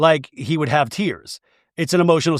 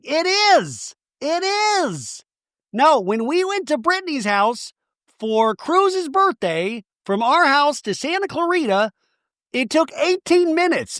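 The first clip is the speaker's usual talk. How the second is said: high-pitched imitation of indignant insistence; mock insistent protest; 11 semitones higher than usual. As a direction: insist in a high, drawn-out mocking voice. Acting out Danny insisting about distance.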